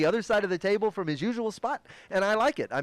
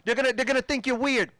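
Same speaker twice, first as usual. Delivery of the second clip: hammed-up boss voice, pitched high and fast; lecturing boss; 9 semitones higher than usual. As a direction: talk down to him like a fed-up manager.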